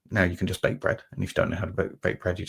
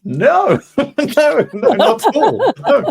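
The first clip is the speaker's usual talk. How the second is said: laughing no; 12 dB louder, much slower than usual. Real, not acted. Laughing off easy work.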